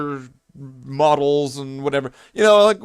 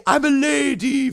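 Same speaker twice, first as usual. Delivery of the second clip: high mock lady voice; Little Britain lady voice; 11 semitones higher than usual. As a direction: pipe it up like a comic drag lady. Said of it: "voice from Little Britain".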